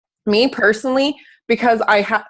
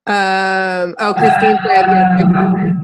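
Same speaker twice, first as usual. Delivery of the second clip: choppy, robotic bad connection; robotic glitchy audio; 3 dB louder, slower than usual. Real, not acted. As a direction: sound garbled like a dropping call. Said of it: "I sound like a weird robot getting drowned".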